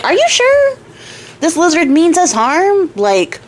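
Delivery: high-pitched, sceptical wife voice; doubting wife. Acted. Wife questions husband about lizard's intentions, high and doubtful.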